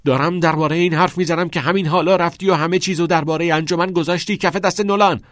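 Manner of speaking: loud, high, rapid-fire yell; accusing shout. A narrator's reading. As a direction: hurl the accusation at full volume. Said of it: "چارلی فریاد کشید".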